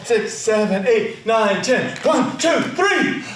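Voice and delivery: brisk rhythmic count, pitch rising sharply at the end; urgent counting. Acted. Performer counting off repeated tens, building to a shout.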